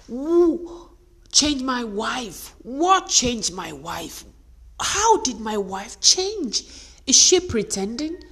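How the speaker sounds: loud, slow, incredulous questions; bewildered husband. Acted. Husband baffled by his wife's change, loudly bewildered. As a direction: puzzle aloud in drawn-out disbelief. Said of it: "he does not understand".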